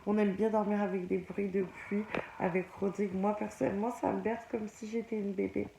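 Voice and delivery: soft, hushed bedtime voice; quiet bedtime talk. Real, not acted. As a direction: talk softly so as not to wake anyone.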